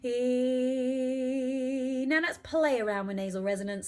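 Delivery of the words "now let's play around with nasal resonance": ordinary delivery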